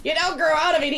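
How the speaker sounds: hammed-up older asthmatic consoling a kid; mock weary adult. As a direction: shout a tired warning to the kid. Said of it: "And I'm like".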